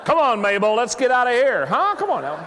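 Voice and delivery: booming merry tenor welcome; God as Irish tenor. Pretend. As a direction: play God as a cheery tenor at heaven's gate. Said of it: "if he's an Irish tenor".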